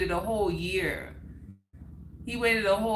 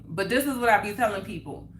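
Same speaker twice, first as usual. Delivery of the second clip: ordinary talk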